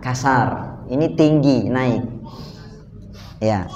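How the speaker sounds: ordinary talk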